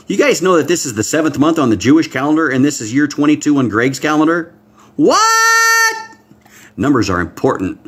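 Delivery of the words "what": high, loud rhetorical yelp; amazed what. Real, not acted. Preacher marveling at the calendar numbers, amazed. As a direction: throw it up high in wonder.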